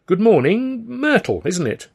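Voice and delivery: high, sing-out hello through a smile; friendly greeting. A narrator's reading. Alf greets the receptionist, friendly and high. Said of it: "said with a friendly smile".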